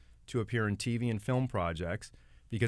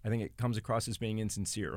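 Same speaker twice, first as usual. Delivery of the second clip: ordinary talk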